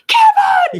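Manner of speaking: high, loud frantic mother's cry with a laugh; mock Catherine O'Hara shout. Imitating the frantic mom calling for her son, loud shout. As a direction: yell it like the panicked mom in Home Alone. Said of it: "she didn't overact in Home Alone, except for maybe".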